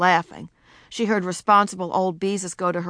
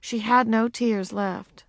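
usual speaking voice

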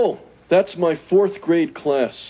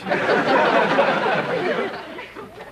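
long breathy self-pitying sigh; 8 semitones higher than usual. Acted. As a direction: let out a heavy, drawn-out sigh. Alone at night, feeling wronged.

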